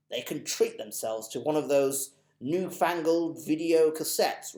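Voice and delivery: put-on potter about like a polite old gent; lightly voiced, dotty old gentleman; fussy old gentleman. Mister Stink fussing over payment.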